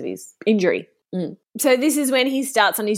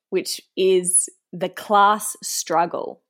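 ordinary delivery

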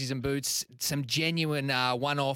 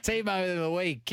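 slow, higher, drawn-out announcer title; announcer intro; 6 semitones higher, slower than usual. Pretend. Host announcing his segment, in a slow, grand announcer voice. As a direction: announce the segment like a big show opener. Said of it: "leave the intro to me".

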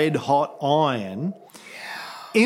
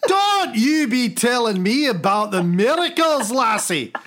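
put-on higher-pitched, belligerent Scottish accent; Scottish God; 5 semitones higher than usual. God threatening to show lassie miracles, belligerent Scottish accent. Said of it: "He must be Scottish".

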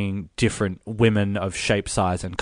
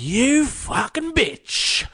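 much higher, slow and stretched, outraged; furious screech; slower than usual. Retold or imitated. Debbie confronting Ruth about her husband, a high furious screech. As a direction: spit it at the friend who betrayed you.

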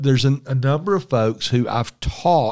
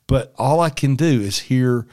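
ordinary talk, nothing marked

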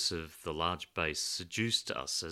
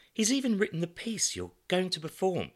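high, bright-edged hostess voice, louder; Samantha, higher and louder; 14 semitones higher, 8 dB louder than usual. A narrator's reading. Samantha tells Alan about Pedro's piece, high and lively. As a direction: play the hostess introducing her guest, high and louder.

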